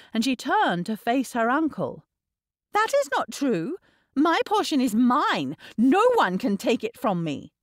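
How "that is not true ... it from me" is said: put-on high, insistent girl; indignant denial. A narrator's reading. Niece contradicting her uncle about dowry, indignant. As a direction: protest hotly that it is yours.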